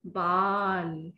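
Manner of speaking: बाल very slowly drawn out; slow model word. Shown as a demonstration. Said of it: "ये कौन सी तस्वीर है". (बच्चों के लिए धीमे बाल)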